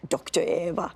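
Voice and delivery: ordinary delivery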